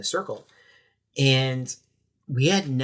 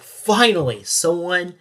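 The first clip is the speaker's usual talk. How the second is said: higher, louder burst of excitement, easing off; excited relief; 6 semitones higher, 6 dB louder than usual. Retold or imitated. Retelling his joy that someone finally called, excited. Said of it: "I just felt so excited".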